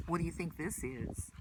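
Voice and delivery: usual speaking voice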